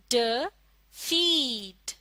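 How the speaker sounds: फीड stretched very slowly; slow reading demo; slow blending. Shown as a demonstration. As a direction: draw फीड out long. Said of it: "फीड क्या लफ्ज है ये?".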